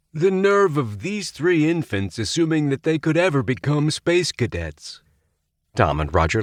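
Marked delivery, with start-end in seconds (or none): 0.1-5.0 mock outrage. A narrator's reading. Astro mock-outraged to friends.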